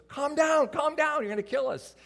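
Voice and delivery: high, loud mock panic from the passenger seat; imitated yelling. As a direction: yell it like a wife fearing a crash. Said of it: "she's yelling at me".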